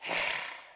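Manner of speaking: put-on irritated sigh; exasperated sigh. What Jesus didn't do to him, exasperated sigh. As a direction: breathe out in mock exasperation. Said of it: "He didn't sigh".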